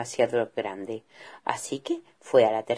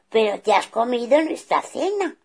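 a hint of stern father bear; 7 semitones higher, 5 dB louder than usual.

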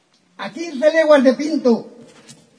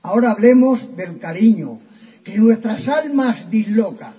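ordinary delivery

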